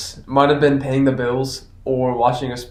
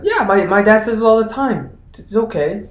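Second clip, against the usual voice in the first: put-on higher, slightly louder child's reassurance; innocent little kid; 8 semitones higher, 5 dB louder than usual. Child to the babysitter, higher and innocent.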